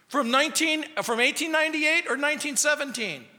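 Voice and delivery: high, quick, excited questions; mock-excited high quizzing; eager curious. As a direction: pounce on the detail. Rescuer quizzing dates.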